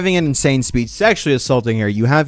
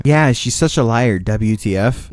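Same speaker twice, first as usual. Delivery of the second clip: ordinary talk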